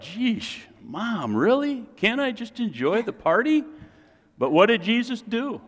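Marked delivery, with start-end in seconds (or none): 0.0-3.6 put-on high whiny teenage protest; sulky teen. Jesus at the wedding complaining to his mom, high whiny teen. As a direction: whine at Mom like a bored teen.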